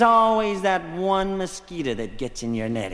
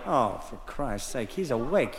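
grumbling shoulder; 8 semitones lower, 5 dB quieter than usual. Acted. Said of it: "My shoulder went".

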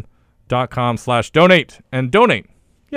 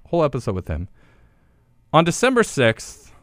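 normal talking voice

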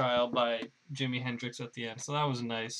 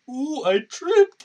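a much higher, louder, slow dopey cry; dumb Hulk; 13 semitones higher, 11 dB louder, slower than usual. Pretend. Dim Bruce Banner announcing his fall, high and slow. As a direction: go up high and slow like a clumsy simpleton. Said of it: "he'll just be really dumb and be like".

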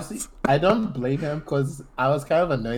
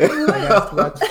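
laughing; 6 semitones higher, 6 dB louder, slower than usual. Real, not acted. Laughing applause.

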